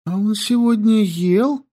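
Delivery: put-on high, lively young girl; girl's voice. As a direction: chatter high and breathless. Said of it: "Yandi voice".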